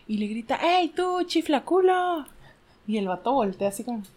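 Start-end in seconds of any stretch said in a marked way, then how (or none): 0.6-2.3 high, slow drawn-out call across the street. Acted. Joke character calling to a man on the street, high drawn call. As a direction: hail an old schoolmate.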